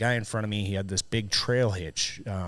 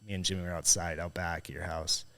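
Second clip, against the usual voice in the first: normal talking voice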